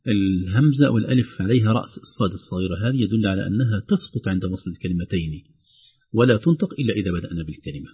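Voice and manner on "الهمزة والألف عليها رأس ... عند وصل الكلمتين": usual speaking voice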